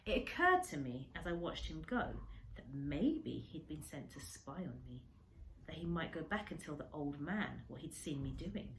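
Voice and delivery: ordinary delivery, nothing marked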